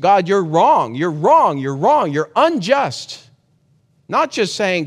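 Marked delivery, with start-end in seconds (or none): 0.0-3.2 louder acted accusation, repeated wrong; accusing God.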